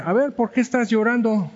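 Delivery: put-on high child's voice asking gently; curious child. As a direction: sound like a little boy asking. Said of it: "Y un niño va pasando y dice". (voz alta de niño curioso)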